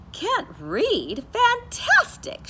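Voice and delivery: very high, loud, slowed Fantastic; delighted bird. Acted. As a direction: gush with joy like a thrilled teacher. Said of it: "She waved her wing".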